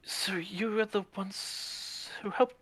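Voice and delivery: slow, hesitant whisper; slow shy whisper. Acted. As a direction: whisper shyly to a stranger. Akari shyly speaking to the cat.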